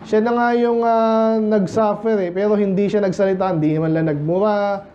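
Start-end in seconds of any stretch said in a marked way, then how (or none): none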